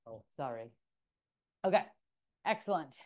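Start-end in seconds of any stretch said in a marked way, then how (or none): none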